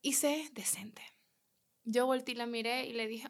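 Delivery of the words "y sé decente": slow whisper; whispered reminder. Retold or imitated. Waitress reminding herself to behave, in a slow whisper. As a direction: hush it to yourself slowly, reining yourself in. Calming herself in her head.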